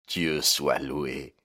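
slow, choked-up thanks; strangled voice. Acted. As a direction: force it out past a tight throat. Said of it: "d'une voix étranglée". (voix étranglée)

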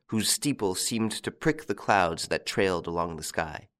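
ordinary delivery, nothing marked

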